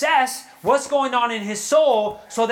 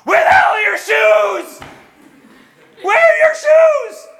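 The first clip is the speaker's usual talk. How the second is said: loud, high shouted demand; furious father shouting; dad yelling; 11 semitones higher, 10 dB louder than usual. Retold or imitated. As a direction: bellow it like an angry dad hunting for shoes. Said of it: "you know what my dad did".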